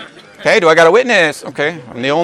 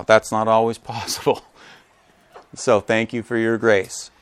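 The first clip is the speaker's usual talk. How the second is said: usual speaking voice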